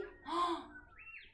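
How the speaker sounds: gasp. Acted. Storytelling suspense gasp.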